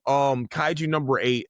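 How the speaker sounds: ordinary talk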